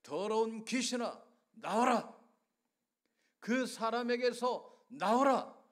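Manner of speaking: forceful exorcism command with loud final words; commanding call. Acted. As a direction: order the demon out with full authority. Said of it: "예수님께서 그를 부르십니다".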